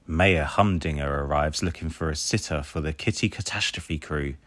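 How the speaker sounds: ordinary delivery, nothing marked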